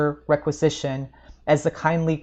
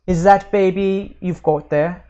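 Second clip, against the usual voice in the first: gruff policeman; 4 semitones higher, 7 dB louder than usual. Acted. Policeman questions the woman.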